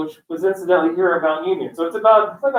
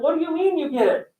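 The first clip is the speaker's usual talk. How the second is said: high, softer puzzled question; baffled surprise; 9 semitones higher, 5 dB quieter than usual. Retold or imitated. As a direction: ask it in high-pitched bewilderment. Acts out baffled parent's question.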